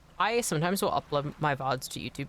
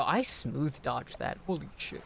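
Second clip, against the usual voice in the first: normal talking voice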